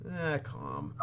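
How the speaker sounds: ordinary talk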